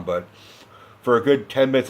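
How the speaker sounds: normal talking voice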